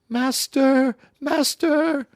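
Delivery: very high, loud calling cry; harp calling out. Acted. The fairy harp calls its master as Jack flees. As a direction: shriek for its master in alarm. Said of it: "the harp called out".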